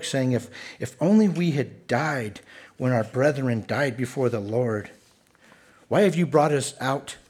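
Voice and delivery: loud, driving reading of the people's complaint; scripture read with rising force; forceful scripture reading. Acted. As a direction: read it out like a preacher building heat. Reads complaint passage aloud forcefully.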